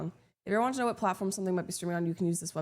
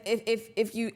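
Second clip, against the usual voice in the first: usual speaking voice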